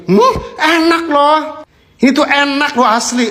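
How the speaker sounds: delighted yum. Real, not acted. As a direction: drag out how good it tastes. Tasting, slow high delight.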